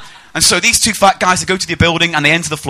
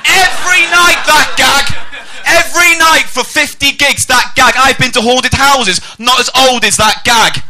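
high, loud, drawn-out ranting; exasperated shout; 11 semitones higher, 6 dB louder, slower than usual. Acted. Comic suffering the same joke nightly, exasperated shout. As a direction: yell in hammed-up despair, stretching the words. Exasperated rant about repeated gag.

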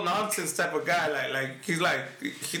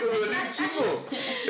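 stretched words through laughter; laughing; 3 semitones higher, slower than usual. Real, not acted. Laughing over his own story.

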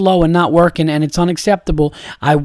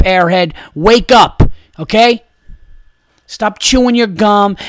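angry yelling; 4 dB louder than usual. Pretend. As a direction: yell at them to wake up. Imagined telling off of the nurses.

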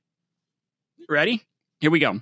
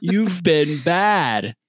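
super slow, dragged-out Sloth voice; slow lumbering Sloth impression, half-hearted; 4 semitones higher, much slower than usual. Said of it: "I'm trying to do my Sloth voice".

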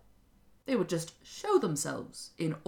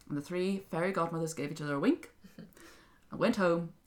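plain reading voice

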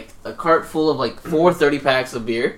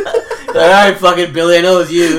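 louder, higher gotcha through laughs; laughing callout; 4 semitones higher, 9 dB louder than usual. Retold or imitated. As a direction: nail the fat kid, laughing. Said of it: "voicing the game leader".

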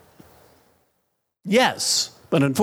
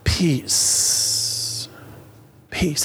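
long, exhaled word, gently settling; calm someone down with a hushed breath; hushed soothing. Retold or imitated. Preacher retelling how they calmed a hurting child, hushed.

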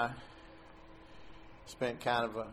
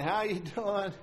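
retelling stepdad's laughing hello, pitched up; laughing greeting; 7 semitones higher than usual. Retold or imitated. Stepdad laughs on the phone to his son. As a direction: chuckle through the greeting. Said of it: "and he's laughing".

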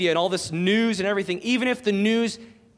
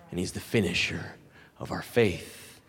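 hushed reverent; 11 semitones lower, 6 dB quieter than usual. Acted. Solemn scripture close.

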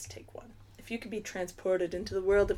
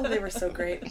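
normal talking voice

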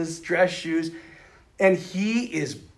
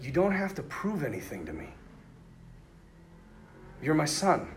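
tender; 10 dB quieter than usual. Retold or imitated. God's tender words.

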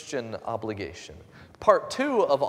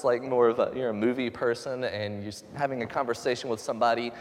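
ordinary talk

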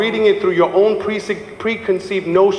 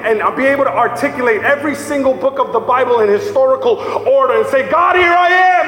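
full-force preaching; 5 semitones higher than usual. Acted. Preacher climaxes over the music, rising to a shout. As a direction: build to a shout.

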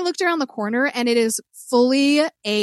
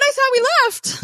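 mock surprise; 14 semitones higher, 3 dB louder than usual. Imagined waking in the house.

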